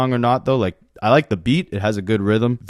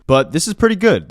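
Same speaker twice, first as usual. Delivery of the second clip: first take punched up with delight; excited praise; 7 semitones higher than usual. Real, not acted. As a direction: gush about the song. Raving about favorite song.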